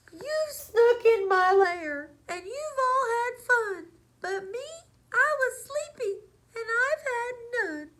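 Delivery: high, loud wailing bear lament; crying bear. Acted. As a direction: blubber like a heartbroken bear. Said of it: "And he whimpers ... and he wails".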